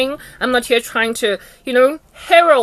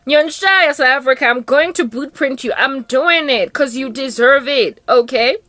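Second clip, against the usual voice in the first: ordinary talk, nothing marked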